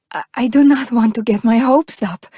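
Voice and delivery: usual speaking voice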